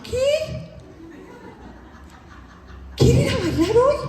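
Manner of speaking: very high astonished question; shocked. Retold or imitated. Mother stunned that her husband suddenly wants to dance, high disbelief.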